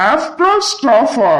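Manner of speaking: high, very slow disbelieving repeat of "After all ஸ்டாஃபா"; outraged echo. Acted.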